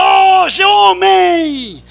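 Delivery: high, loud and slow shouted call; street-preacher shout. Retold or imitated. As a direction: call out to the crowd from the truck. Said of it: "Eu gritava". (pregador gritando do caminhão para a multidão)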